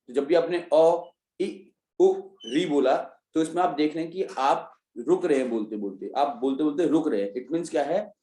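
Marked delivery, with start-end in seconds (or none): none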